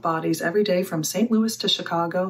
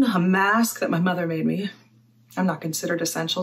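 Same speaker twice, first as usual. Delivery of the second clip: ordinary talk, nothing marked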